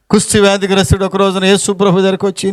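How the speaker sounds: ordinary talk, nothing marked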